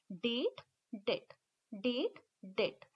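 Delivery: डेट said slowly, alternating short and long vowel; contrasting vowels. Shown as a demonstration. Teacher slowly contrasting the two vowels. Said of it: "इस word को pronounce करके देखिए".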